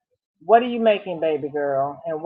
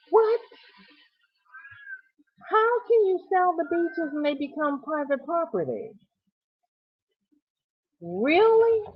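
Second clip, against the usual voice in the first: much higher, outraged disbelief; can't believe they sold the beach; shocked disbelief; 11 semitones higher than usual. Real, not acted. Host hearing the local beach was sold: high shocked disbelief.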